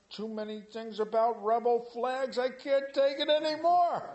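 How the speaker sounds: high, loud, fed-up cry; exasperated outburst. Real, not acted. Preacher fed up with Facebook posts, exasperated high cry. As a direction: throw up your hands, you've had enough.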